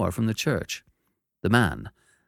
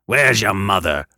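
gruff convict; 7 dB louder than usual. Acted. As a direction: growl the order. Convict asks.

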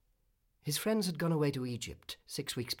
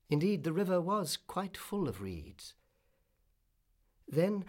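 plain reading voice

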